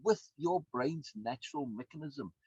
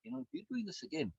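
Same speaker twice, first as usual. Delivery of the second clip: voicing the brain; 9 semitones higher, 5 dB quieter than usual. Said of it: "Your brain says".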